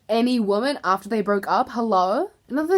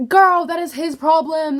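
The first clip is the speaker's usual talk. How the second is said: higher, louder call-out; 6 semitones higher, 6 dB louder than usual. Real, not acted. Telling fans off over the breakup, loud and exasperated. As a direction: snap it at the fans.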